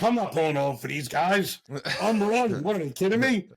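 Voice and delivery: confused dad refusing cops; defiant dad. Retold or imitated. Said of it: "he's like".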